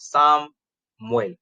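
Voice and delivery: Sa-muel, said slowly to split the syllables; teacher spelling out syllables slowly; slow syllable demo. Shown as a demonstration. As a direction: stretch the name out so the break is heard. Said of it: "Samuel has two syllables".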